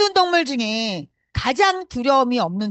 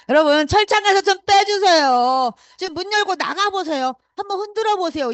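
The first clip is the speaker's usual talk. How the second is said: rousing exhortation; 8 semitones higher, 5 dB louder than usual. Real, not acted. Exhorting audience to break free.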